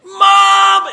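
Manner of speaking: high, loud yell for his mother; child yelling Mom. Retold or imitated. Lost kid in store calling for his mother, high loud yell.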